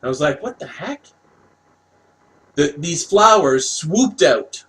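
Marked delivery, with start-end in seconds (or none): 0.4-1.1 high, hushed disbelief; small high-pitched, soft gasp of a question; retold startled reaction. Telling of the swooping flowers, startled and hushed.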